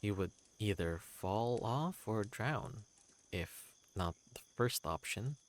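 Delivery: ordinary delivery, nothing marked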